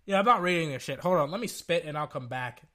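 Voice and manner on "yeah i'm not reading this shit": talking through throat phlegm. Real, not acted. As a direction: speak with phlegm in the throat, needing to spit. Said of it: "there's still phlegm in my throat".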